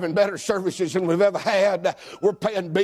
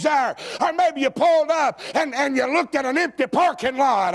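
building sermon; 8 semitones higher than usual. Acted. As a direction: climb from urgent talk to a full shout. Preaching builds to a shout.

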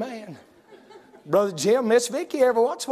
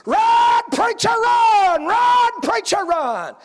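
high, loud yelling cheer; crowd screaming; 10 semitones higher, 4 dB louder, much slower than usual. Retold or imitated. Church softball crowd cheering the running preacher, loud shout. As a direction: scream him home like the whole bleachers. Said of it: "I heard people go to screaming".

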